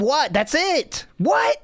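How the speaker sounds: rising incredulous "What?" climbing high; mock outrage at a penalty call; retold indignant what. Parent retelling his protest at the ref, rising mock outrage. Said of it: "But I just said".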